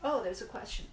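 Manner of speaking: ordinary talk, nothing marked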